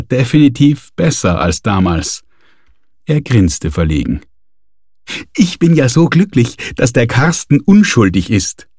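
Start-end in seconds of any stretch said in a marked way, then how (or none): 5.4-8.7 bright, rising joy; jubilant. A narrator's reading. Manuela cheering that Karsten is innocent, jubilant. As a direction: cheer it out happily. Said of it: "jubelte die Manuela".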